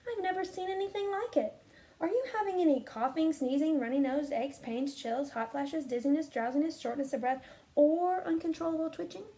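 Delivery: fussy doctor. Acted. Doctor quizzing Camilla about symptoms, fast and high. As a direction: rattle off symptoms like a busy doctor. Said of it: "Doctor Bumble".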